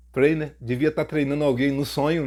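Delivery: ordinary talk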